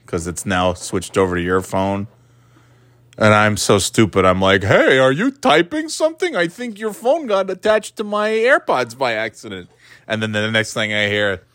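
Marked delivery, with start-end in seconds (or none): 4.6-9.7 high mock voice. Retelling own voice to partner.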